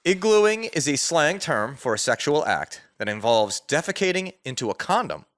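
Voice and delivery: ordinary delivery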